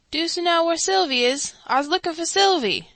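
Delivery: put-on a small boy looking for his sister; little boy. A narrator's reading. Little boy Bruno asks.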